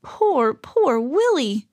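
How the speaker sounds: slow, high, pitying lament; slow and tearfully sympathetic; almost in tears. A narrator's reading. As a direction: draw it out with aching pity. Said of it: "Alexander was almost in tears".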